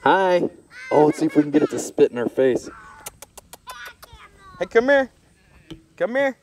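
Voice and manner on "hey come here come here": high, coaxing call to a camel; calling an animal. Real, not acted. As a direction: sing it up high to lure the animal over.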